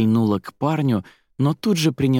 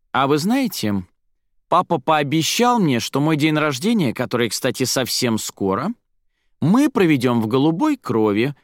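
higher-pitched self-satisfied boasting; haughty, smug; 5 semitones higher than usual. A narrator's reading. Zaira boasts to the company about her birthday, haughty and smug. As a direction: show off, looking down on everyone. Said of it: "очень высокомерно и самодовольно произнесла".

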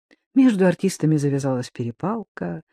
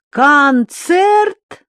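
high, loud, slow announcing; loud announcement; 9 semitones higher, 6 dB louder, much slower than usual. Acted. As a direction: announce it to the hall. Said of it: "объявила Тося".

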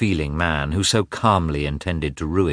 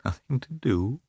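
"Nothing to do?" muttered softer, a higher disbelieving rise; tearful mutter; 7 semitones higher, 6 dB quieter than usual. A narrator's reading. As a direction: softly echo "Nothing to do?" near tears. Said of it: "she muttered, with tears in her eyes".

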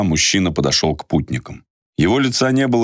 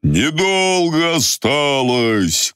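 calling out; 11 semitones higher, much slower than usual. Acted. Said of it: "крикнул старик".

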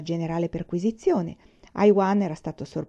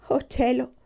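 higher wailing lament; 6 semitones higher than usual. A narrator's reading. Jailer wailing as the prisoners leave, lamenting. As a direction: moan it to the heavens. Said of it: "si lagnò il carceriere".

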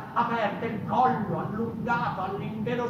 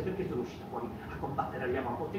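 hushed rapid question; 8 semitones lower, 8 dB quieter, much faster than usual. Acted. Hushed bewildered self-questioning.

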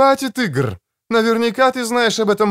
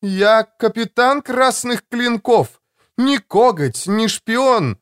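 rising, higher indignant protest; indignant outburst; 10 semitones higher, slower than usual. Acted. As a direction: bristle with offended pride. Ластара гневно возражает. (возмущённо, на повышенных тонах)

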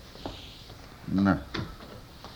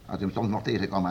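ordinary talk

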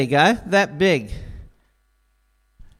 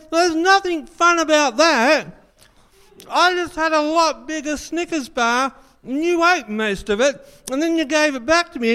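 higher, aggrieved moaning about the candy bar; whiny complaint; 7 semitones higher than usual. Acted. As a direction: whine like a cheated friend. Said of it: "You go".

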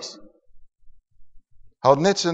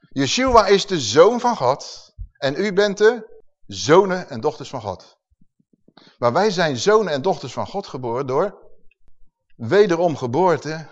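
ordinary talk

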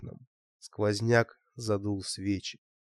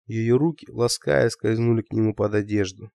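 ordinary delivery, nothing marked

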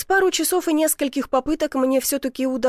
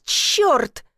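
short yelp of a curse, pitched up; yelled curse; 4 semitones higher, 4 dB louder than usual. A narrator's reading. Man with soot in his eyes, yelled curse. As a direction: swear loudly in shock. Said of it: "заорал, зажмурившись".

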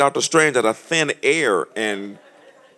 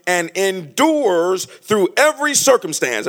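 much higher, louder preaching, slow; preaching at full force; 10 semitones higher, 9 dB louder than usual. Acted. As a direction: preach the love passage to the crowd. Preaching the passage.

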